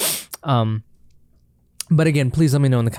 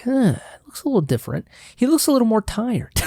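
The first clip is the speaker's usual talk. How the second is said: higher, quicker puzzled viewer voice; high mock viewer; 10 semitones higher than usual. Viewer squinting at his eyeliner, high and puzzled. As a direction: play a puzzled viewer, pitched up and fast. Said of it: "you were like".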